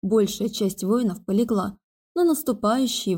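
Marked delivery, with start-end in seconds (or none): none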